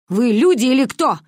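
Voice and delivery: grandmother's loud rhetorical reproach; exasperated shout. A narrator's reading. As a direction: cry out at the children in indignation. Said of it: "выкрикнула она".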